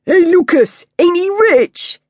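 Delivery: high, loud youth voice, slowing on the question; jeering gang kid. A narrator's reading. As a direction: tease him for the crowd.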